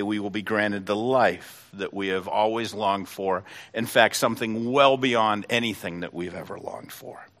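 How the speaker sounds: ordinary talk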